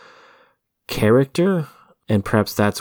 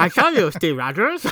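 a hammy German Red Skull; German accent; 10 semitones higher than usual. Retold or imitated. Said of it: "But with a German accent".

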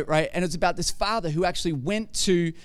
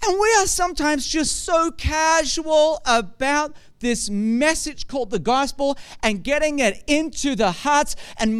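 preaching at full force; 6 semitones higher, 4 dB louder than usual. Acted. Pastor presses the congregation about sharing the gospel, preaching hard. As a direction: drive the point home to the church.

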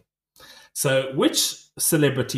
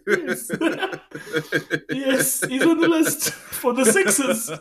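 high and slow through laughter; laughing; 10 semitones higher, slower than usual. Real, not acted. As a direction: laugh while saying it. Laughing at own joke.

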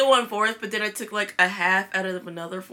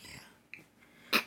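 whisper; 15 dB quieter than usual. Retold or imitated. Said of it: "I was like".

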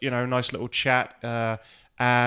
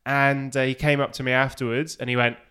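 ordinary talk

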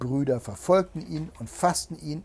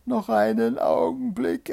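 high, loud, urgent plea; pleading wife; 10 semitones higher, 7 dB louder than usual. A narrator's reading. Wife begs Bluebeard for a moment, pleading. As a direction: plead for time in a raised voice.

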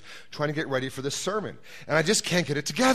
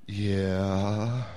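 low, drawn-out sheepish "Yeah"; 6 semitones lower than usual. Acted. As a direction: answer like you've been caught. Mike answers God.

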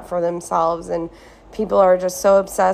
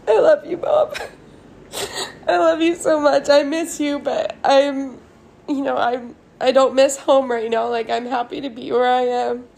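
higher, laughing through real tears; crying laugh; 6 semitones higher than usual. Real, not acted. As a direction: let the tears break into the words. Tearful love to mom.

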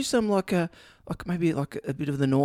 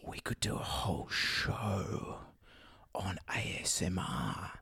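slow unvoiced whisper; 7 semitones lower, 12 dB quieter, slower than usual. Shown as a demonstration. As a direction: whisper it slowly and softly. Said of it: "Is anyone getting tingles at all?".

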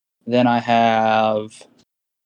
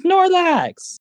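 high delighted shout of the name; excited squeal. Real, not acted. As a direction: squeal it with glee. Excited at plushie news.

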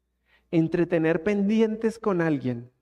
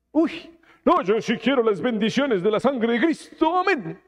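mock pious believer; 2 semitones higher than usual. Imita al cristiano superficial.

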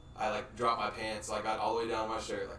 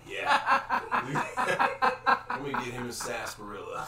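laughing joke; 2 semitones higher, 8 dB louder than usual. Real, not acted. Joking with a friend about the sarsaparilla, laughing. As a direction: giggle out the gag.

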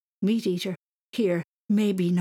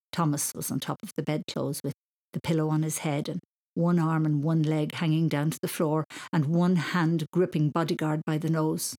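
ordinary delivery, nothing marked

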